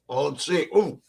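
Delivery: talking while chewing; words around a bite of food; mouth full. Real, not acted. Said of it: "Don't talk with your mouth full of food".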